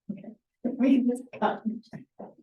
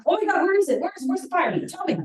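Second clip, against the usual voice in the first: much higher, louder, frantic dispatcher; panicked dispatcher; 10 semitones higher, 4 dB louder, faster than usual. Acted. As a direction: let the dispatcher lose it and rush. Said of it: "then we would just get amped up".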